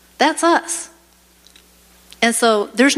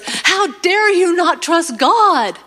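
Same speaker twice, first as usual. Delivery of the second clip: rebuking; 9 semitones higher, much slower than usual. Retold or imitated. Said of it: "I remember she goes".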